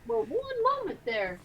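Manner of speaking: high, slow mock drawl; character voice. Player in character stalling the sheriff, lightly hammed-up drawl. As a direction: stretch it out in a put-on voice.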